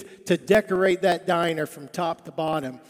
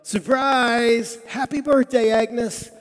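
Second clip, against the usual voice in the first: high, loud, drawn-out cheer; party yell; 5 semitones higher, 4 dB louder, slower than usual. Retold or imitated. Diners jumping up as Agnes walks in, yelling. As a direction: call out a surprise greeting, imitating a crowd. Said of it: "they all jump up and yell".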